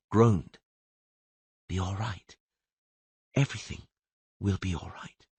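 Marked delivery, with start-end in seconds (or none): 1.7-5.3 soft, quiet, soothing murmur; calm the dying man gently; gentle soothing voice. Acted. Kneeling stranger soothing the dying Toublon, softly. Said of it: "a quiet, gentle, soothing voice".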